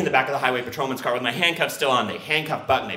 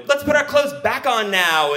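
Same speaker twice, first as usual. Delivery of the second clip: high, bright eager suggestion; 10 semitones higher, 3 dB louder than usual. Retold or imitated. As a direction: pitch it up, keen and eager. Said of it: "we were like".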